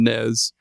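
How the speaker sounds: drawn-out "nes" ending in a Z sound. Retold or imitated. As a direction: say "nes" slowly, like "nezz". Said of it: "There's no Z there".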